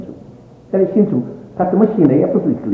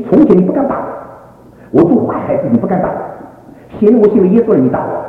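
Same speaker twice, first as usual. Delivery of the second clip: high, loud retold outburst at father; hurt protest; 10 semitones higher, 4 dB louder than usual. Son protests being hit after believing, indignant. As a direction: protest the slap with raised voice.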